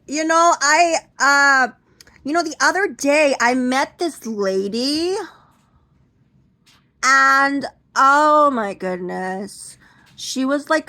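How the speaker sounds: high, loud, slow gushing chatter; hammed-up chatty aunt. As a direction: gossip sweetly like the aunt. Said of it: "She'll be like".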